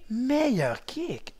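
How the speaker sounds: meilleur stretched very slowly and softly; slow drawn-out. Shown as a demonstration. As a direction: draw out meilleur kick. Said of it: "tu dis meilleur kick".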